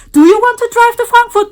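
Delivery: high, loud, fast eager question; mock hologram voice. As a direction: ask it high, loud and fast like a pushy stranger. Holograms talk about Frankfurt.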